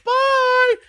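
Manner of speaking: put-on high shouted child goodbye; kid voice. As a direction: yell it like a little kid. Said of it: "the kids were like".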